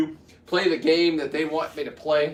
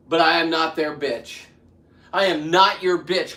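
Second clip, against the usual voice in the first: louder, higher defiant declaration; emotional defiance; 4 semitones higher, 6 dB louder than usual. Real, not acted. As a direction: stand your ground, heated. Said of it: "right now I'm very emotional".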